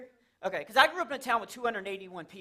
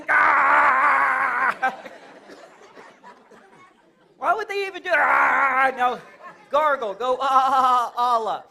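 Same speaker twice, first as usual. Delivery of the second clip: mock terrorist yell; 5 semitones higher, 11 dB louder, much slower than usual. Imitating gargling terrorist yelling.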